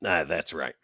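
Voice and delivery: ordinary talk, nothing marked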